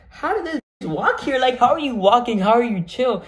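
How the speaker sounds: high, loud mock astonishment; incredulous amazement. Worker seeing the bite patient walking, imitated incredulous amazement. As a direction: voice a stunned onlooker's thoughts, pitched well up.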